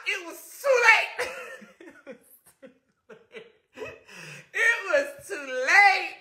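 high, slowed telling through laughter; near-tearful laughing retelling. Real, not acted. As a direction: laugh so hard the voice climbs. Laughing while telling the flip.

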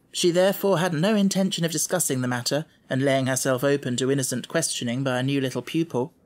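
usual speaking voice

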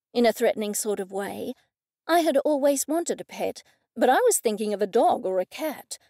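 usual speaking voice

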